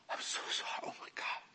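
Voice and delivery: whispered, overwhelmed apology; imitated tearful apology. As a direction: whisper as if fighting back tears. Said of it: "his eyes filled with tears".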